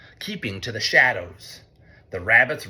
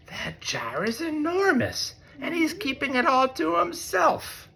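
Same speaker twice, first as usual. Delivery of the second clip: high squeaky mouse voice, not whispered; a tiny mouse frets over the big jar. A narrator's reading. Parent reading to child, voicing Mouse as a high squeaky mouse. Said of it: "whispered Mouse".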